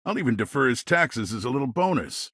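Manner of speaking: normal talking voice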